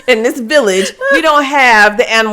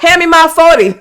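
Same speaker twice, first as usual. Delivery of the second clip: louder, higher gangster goat; mock gangster; 6 semitones higher, 7 dB louder than usual. Animal demanding its gun, mock gangster. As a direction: play an animal talking tough.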